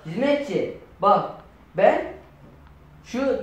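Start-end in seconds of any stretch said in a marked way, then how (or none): none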